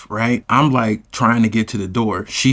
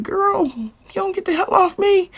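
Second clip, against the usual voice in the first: much higher, fed-up shriek; exasperated squeal. Retold or imitated. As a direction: shake her off with a high squeal. Shaking Keisha off.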